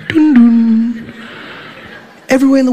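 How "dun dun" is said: chime sound imitation.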